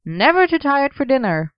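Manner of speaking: eager. Acted. Merlin eager.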